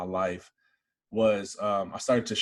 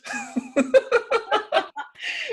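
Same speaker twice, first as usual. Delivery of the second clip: big high burst of laughter. Real, not acted. Laughs at the doctor's order.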